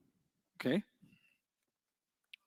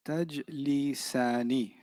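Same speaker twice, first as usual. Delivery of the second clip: slow pronunciation; 5 semitones lower, 8 dB quieter, much slower than usual. Shown as a demonstration.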